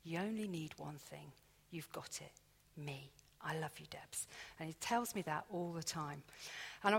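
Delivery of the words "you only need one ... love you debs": soft, sinking to a whisper at the end; God quietly reassuring the worried Debs; hushed reassurance. Retold or imitated. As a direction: comfort her gently, fading to a whisper. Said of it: "he's always saying to me".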